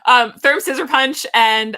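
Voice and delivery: ordinary talk, nothing marked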